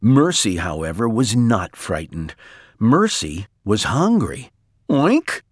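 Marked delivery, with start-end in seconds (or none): 4.9-5.4 short high oink, tipped upward; pig's oink. A narrator's reading. Mercy the pig, hungry on the bed, high oink. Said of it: "said Mercy again".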